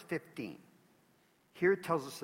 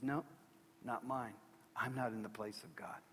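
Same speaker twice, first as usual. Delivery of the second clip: soft voice; 5 semitones lower, 9 dB quieter than usual. Acted. Quiet Joseph voice.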